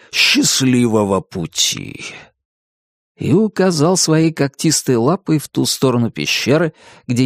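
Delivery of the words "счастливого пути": low, slow dragon farewell; see them off with drawn-out courtesy; polite dragon, stretching the words; courteous dragon. A narrator's reading. Dragon bidding guests farewell.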